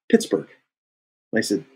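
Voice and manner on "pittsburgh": short falling answer, higher-pitched; retold blunt answer. Director naming his hometown to actor, blunt. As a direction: imitate a director stating his hometown firmly. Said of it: "And he goes".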